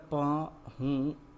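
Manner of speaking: पहुंचना split into separate syllables; पहुंचना in pieces, a gap after each syllable. Shown as a demonstration. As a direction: spell out पहुंचना slowly, sound by sound. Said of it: "तो क्या शब्द बना".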